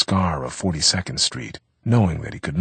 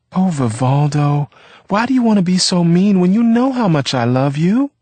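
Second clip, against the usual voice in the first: much higher and louder wounded plea; exasperated, near tears; 12 semitones higher, 8 dB louder than usual. A narrator's reading. Girlfriend wounded by Vivaldo, exasperated and near tears. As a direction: cry out at his meanness, close to tears. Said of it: "She would sound exasperated and very close to tears".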